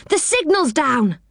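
high, excited calling out; yell. A narrator's reading. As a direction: shout the news, keeping it controlled. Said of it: "yelled Rick".

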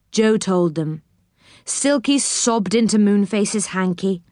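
ordinary delivery, nothing marked

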